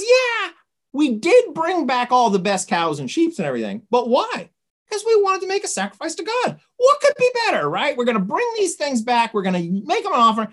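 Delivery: high, eager self-justifying retelling; defensive excuse. Retold or imitated. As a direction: protest innocence in a high voice. Saul defends himself to Samuel.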